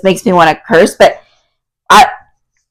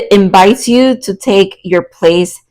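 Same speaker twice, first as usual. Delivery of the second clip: usual speaking voice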